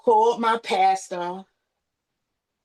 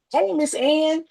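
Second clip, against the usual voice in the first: higher, louder cheerful hello; father's greeting; 9 semitones higher, 5 dB louder, slower than usual. Retold or imitated. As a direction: greet her brightly as her father. Dying father's bright greeting.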